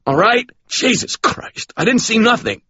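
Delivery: high, strained plea from a man in a wrist lock; pained panting denial. Acted. Ray begs the cop pinning him to the floor, high strained panting plea. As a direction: squeeze it out high and desperate, gasping on Jesus Christ.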